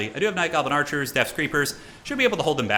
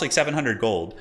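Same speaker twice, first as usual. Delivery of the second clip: ordinary delivery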